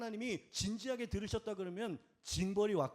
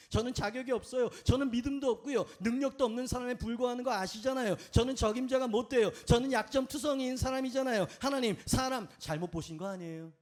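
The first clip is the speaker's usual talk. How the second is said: louder, pitched-up protest of unworthiness; acted whiny objection, raised voice; self-pitying lament; 3 semitones higher, 5 dB louder than usual. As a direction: plead your inadequacy in a rush of excuses. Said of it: "신세한탄이라고 말하죠".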